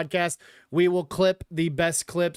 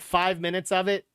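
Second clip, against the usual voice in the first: normal talking voice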